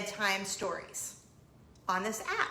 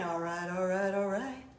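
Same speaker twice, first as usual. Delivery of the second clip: bad Matthew McConaughey; 3 semitones lower than usual. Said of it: "It's a terrible Matthew McConaughey impression".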